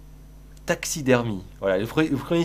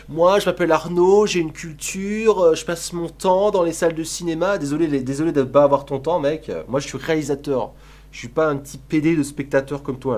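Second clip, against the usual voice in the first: voix moqueuse plus aiguë et plus forte, prétentieuse; imitation d'Arnaud; 4 semitones higher, 6 dB louder than usual. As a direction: singer un cinéphile snob qui se vante. Mocks the chatter Arnaud. (parodie du spectateur Arnaud, aiguë et forte)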